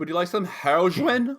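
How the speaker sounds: high, put-on salesman offer; mock street dealer. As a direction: imitate a dealer's casual pitch, hammed up.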